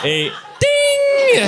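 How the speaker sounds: very high shouted ding; game show ding. Retold or imitated. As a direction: ring out like a quiz show bell.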